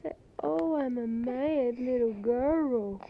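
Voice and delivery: high, loud sing-song cooing to a crying infant. Real, not acted. Mother soothing her crying baby daughter on tape, loud high baby talk.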